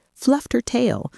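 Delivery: ordinary delivery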